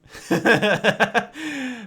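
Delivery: loud high cackling laugh; burst of laughter. Real, not acted. Streamer cracking up at the in-game text, loud laugh.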